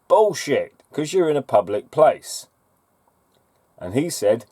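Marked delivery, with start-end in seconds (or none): 0.1-2.5 angry retort. Real, not acted.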